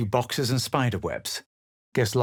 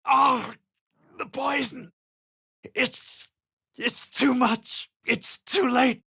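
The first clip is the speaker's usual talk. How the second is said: panicked cry; 9 semitones higher than usual. Acted. Poisoned caster crying out.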